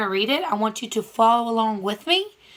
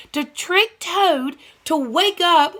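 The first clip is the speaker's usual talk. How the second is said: ordinary talk, nothing marked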